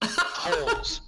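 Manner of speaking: high, loud laughing Halls; laughing through the word. Real, not acted. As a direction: blurt Halls while cracking up. Repeating the name amid laughter.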